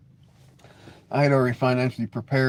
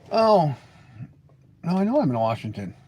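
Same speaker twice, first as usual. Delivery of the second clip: ordinary delivery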